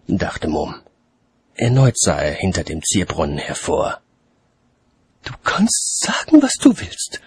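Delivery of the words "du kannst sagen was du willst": high-pitched lady character voice; woman's voice. A narrator's reading. Lady calling from fountain above watchman, high voice. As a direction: speak in a raised, ladylike register. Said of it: "erklang eine Stimme über ihm".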